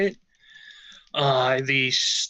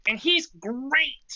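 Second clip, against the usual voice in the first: high, slow little-guy voice; Snacks voice; 9 semitones higher, slower than usual. Shown as a demonstration. Player shows off his character Snacks in a high, slow voice. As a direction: pitch it way up and take it slow for the tiny character. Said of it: "And he talks like that all the time".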